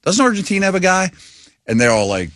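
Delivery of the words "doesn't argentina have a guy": pitched well up, asking in mock innocence; hopeful, pitched-up dad question; mock clueless dad. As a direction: play the clueless dad who half knows one name. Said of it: "I'm like".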